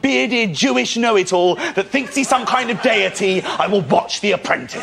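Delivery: high-pitched indignant rant; exasperated retort. Acted. Son answering his preachy dad, high exasperated rant. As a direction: argue back in one high, incensed run.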